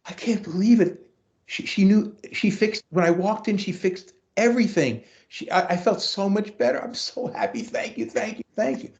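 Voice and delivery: high, excited gushing; breathless grateful student; overjoyed student. Retold or imitated. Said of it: "she came out".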